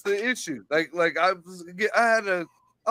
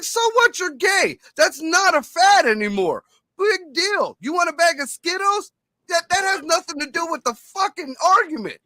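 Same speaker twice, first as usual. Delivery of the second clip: higher, scornful retelling; high-pitched mock scorn in an argument; mock dismissive retort; 7 semitones higher than usual. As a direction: brush him off, exasperated.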